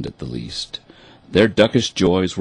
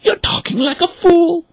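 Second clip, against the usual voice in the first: high, loud scolding duck; high loud mother duck rebuke; 5 dB louder than usual. Acted. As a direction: scold your silly duckling. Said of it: "the mother duck exclaimed".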